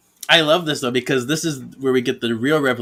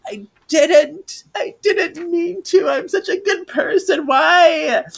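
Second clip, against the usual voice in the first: much higher, wailing mock cry; hammed-up sobbing; 4 dB louder than usual. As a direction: wail it out like an over-the-top crybaby. Prue breaks down, acted comic quote.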